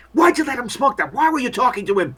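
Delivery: high-pitched accusing outcry, not very loud; mock yelling. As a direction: berate them as an angry bystander. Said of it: "They start yelling at you".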